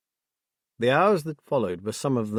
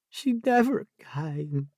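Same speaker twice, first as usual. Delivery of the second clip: croaked; 5 semitones higher, much slower than usual. A narrator's reading. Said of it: "she croaked".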